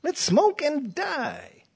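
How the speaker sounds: high, slow put-on voice of people mocking life; mocking careless people. Preacher mocks worldly people: high, slow, sneering. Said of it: "People are going".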